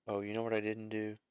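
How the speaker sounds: usual speaking voice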